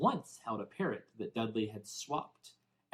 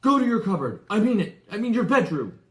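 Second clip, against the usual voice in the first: higher, much louder, breathless bark; wheezing order; 10 semitones higher, 11 dB louder than usual. A narrator's reading. Winded uncle ordering Harry away after the scuffle. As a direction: gasp out the order after the fight. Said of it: "he wheezed at Harry".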